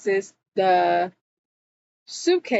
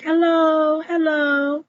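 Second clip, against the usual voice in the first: imitated calling out; 8 semitones higher, 3 dB louder than usual. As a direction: call out high, as if seeking someone in a shop. Dee calling out in shop.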